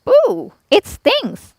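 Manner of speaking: very high, slow whining complaint; whimpering thief. A narrator's reading. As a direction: whine about the stings. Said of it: "Lan said".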